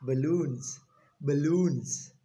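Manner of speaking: "Balloons" said buh-LOONS, stress on the second syllable; correct balloons. Shown as a demonstration. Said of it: "but what?".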